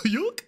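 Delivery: high, goofy "a-hyuck"; Goofy's laugh. Acted. Streamer showing off impressions, Goofy laugh. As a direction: hiccup a cartoon hyuck. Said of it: "Goofy's laugh".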